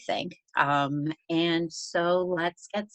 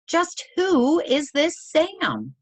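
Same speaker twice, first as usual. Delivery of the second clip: much higher, slow, drawn-out wondering; puzzled wonder; 13 semitones higher, 5 dB louder, slower than usual. Acted. Librarian puzzling over the mystery author, high slow amazed wondering. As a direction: let the question hang in amazement.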